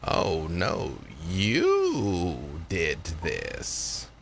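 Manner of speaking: low, slow, drawn-out spirit elder; Granny. Acted. Granny crediting the girl with the deed, low and drawn out. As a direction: stretch the words out, pitched low. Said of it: "Granny's voice".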